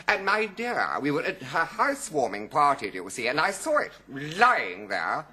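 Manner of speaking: hammed-up bus queue confidante; gossiping woman. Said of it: "this woman in the bus queue was saying".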